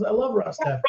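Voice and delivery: retold high-pitched fan enthusiasm; doktor taklidi. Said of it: "He goes".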